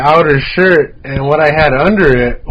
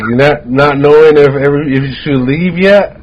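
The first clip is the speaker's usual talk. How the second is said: ordinary talk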